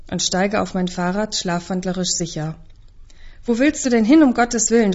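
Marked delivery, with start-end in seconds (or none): 3.4-4.9 shrill and alarmed, higher and louder. Acted. Mother calls after her daughter in panic, shrill and loud. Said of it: "mit schriller Stimme".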